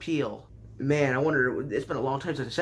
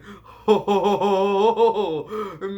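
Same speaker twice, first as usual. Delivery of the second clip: burst of real laughter; 5 semitones higher, 7 dB louder than usual. Real, not acted. Laughing at own joke.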